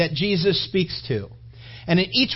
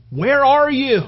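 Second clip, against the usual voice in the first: calling out; 7 semitones higher, 5 dB louder, slower than usual. Acted. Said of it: "said to him".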